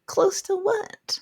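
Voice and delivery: very high, drawn-out question; mock bafflement. Reader questions the characters' claim, high baffled tone. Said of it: "And I was like".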